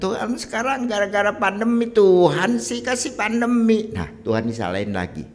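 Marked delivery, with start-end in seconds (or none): 0.0-3.9 high, loud mock whine; hammed-up grumbling at heaven; complaining to God. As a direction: gripe loudly with stretched-out endings. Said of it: "Kita boleh mengeluh sama Tuhan".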